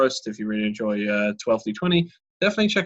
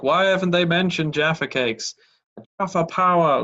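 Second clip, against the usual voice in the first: higher mock viewer complaint; lightly voiced fan outcry; clamoring fans; 6 semitones higher than usual. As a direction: imitate eager commenters, pitched up.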